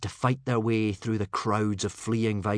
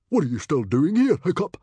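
loud, raised voice of a stern father; 8 semitones higher, 8 dB louder than usual. A narrator's reading. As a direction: demand an answer from your son. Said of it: "asked his father sternly".

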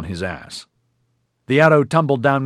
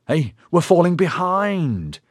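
slow, higher, put-out; impatient; 9 semitones higher, 4 dB louder, slower than usual. Acted. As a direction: hurry them along, annoyed. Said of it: "long-talked impatiently".

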